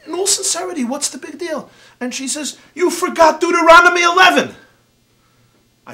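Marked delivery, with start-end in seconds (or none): 0.1-1.7 high, wide-eyed innocent question; mock innocence. Rabbi feigns innocence to rebbetzin. 2.7-4.5 loud, high scolding comeback; triumphant retort. Retold or imitated. Rebbetzin fires back a verse.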